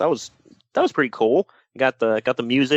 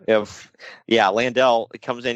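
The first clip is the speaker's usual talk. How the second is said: ordinary talk, nothing marked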